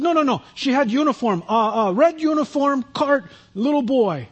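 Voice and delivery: put-on high, emphatic Eastern European accent. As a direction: insist in broken, Eastern European-accented English. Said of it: "real heavy kinda Eastern European accent".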